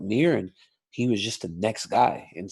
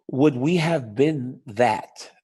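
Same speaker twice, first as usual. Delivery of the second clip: ordinary delivery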